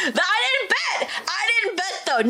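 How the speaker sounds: high indignant laughing denial; playful protest. Real, not acted. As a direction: defend yourself, laughing. Protesting she never bet.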